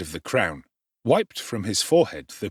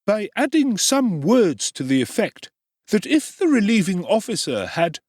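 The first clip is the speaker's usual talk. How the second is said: the obsequious undertaker, pitched up high; high ingratiating tradesman; oily undertaker; 10 semitones higher, 4 dB louder than usual. Acted. Undertaker voiced in story.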